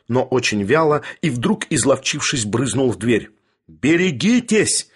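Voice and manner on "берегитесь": high, drawn-out warning call; cry after someone. A narrator's reading. Bormental's warning pursues fleeing Sharikov, crying out. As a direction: call it out slowly at raised pitch. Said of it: "донёсся ему вдогонку барменталевский крик".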